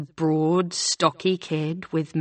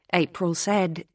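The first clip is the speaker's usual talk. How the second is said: plain reading voice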